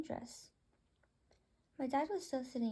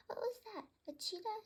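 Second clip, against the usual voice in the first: pitched way up, asking in alarm as a small bird; high bird voice; 9 semitones higher than usual. Acted. One bird asking another what that noise was, high bird voice. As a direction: squeak it out like a startled little bird.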